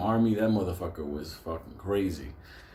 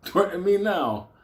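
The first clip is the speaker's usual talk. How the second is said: mock threat; 10 semitones higher, 4 dB louder than usual. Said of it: "and he says".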